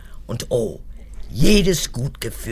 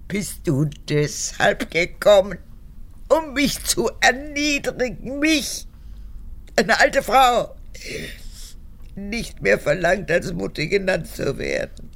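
much higher, slow, wounded whimper; self-pitying wail; 8 semitones higher than usual. Acted. Mother guilt-trips her daughter as a frail old woman, high and slow. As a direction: play the poor wronged old woman, drawn out. Mother plays the humiliated victim.

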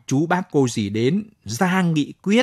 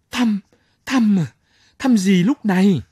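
high, loud startled repetition; shocked outburst; 8 semitones higher, 5 dB louder than usual. Acted. Company commander shocked a wife came to visit, high loud disbelief. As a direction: blurt it in disbelief.

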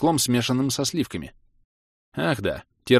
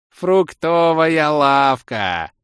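slow, loud, higher announcer call; drawn-out announcement; 7 semitones higher, 7 dB louder, much slower than usual. Acted. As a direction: stretch it out like a tour guide. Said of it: "протяжно оповестил лысый эльф".

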